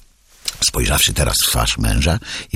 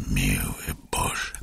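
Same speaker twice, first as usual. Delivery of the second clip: "miły Boże" whispered, slow and much softer; fading whisper; 5 semitones lower, 10 dB quieter, much slower than usual. A narrator's reading. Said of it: "powtarzał, kiwając głową".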